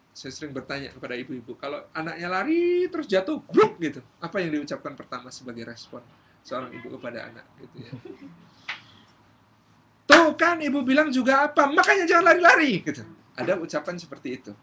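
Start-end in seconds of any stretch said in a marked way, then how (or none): 1.9-3.4 raised, louder run-up to the fall; animated telling. Acted. Imitates child running, then falling. 3.5-3.8 loud, high "bruk" crash; explosive falling noise; imitated thud. 10.1-12.8 loud, high shrieked telling-off; hammed-up angry mom yell; scolding mother. Mother scolding fallen child.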